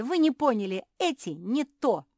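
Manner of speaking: loud, high, exasperated protest; yelling. A narrator's reading. Sister insisting her shoes are wrong, yelling. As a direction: shout that they have it all wrong. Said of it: "завопила Жози".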